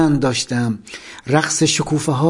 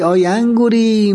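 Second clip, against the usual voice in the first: vendor's call; 6 semitones higher than usual. Retold or imitated.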